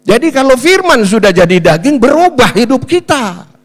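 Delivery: lantang, nada tinggi penuh tenaga; khotbah berapi-api. Real, not acted. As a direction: serukan inti khotbah sekuat tenaga. Berkhotbah dengan tenaga penuh. (berkhotbah dengan suara menggelegar)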